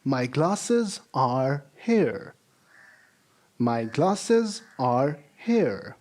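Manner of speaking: very slow, careful reading; slow model sentence. Shown as a demonstration. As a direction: read each word slowly for learners. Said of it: "the second sentence is".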